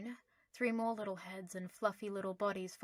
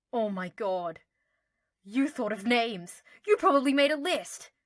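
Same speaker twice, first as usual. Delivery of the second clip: gleeful teasing; 3 semitones higher, 12 dB louder than usual. Acted. Harry teasing Draco about secretly naming owls. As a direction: catch him out with glee.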